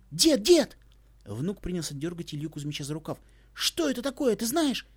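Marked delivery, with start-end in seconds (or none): 0.1-0.8 high, loud call. A narrator's reading. Boy calls grandpa. 3.5-4.9 high, eager asking; excited question. A narrator's reading.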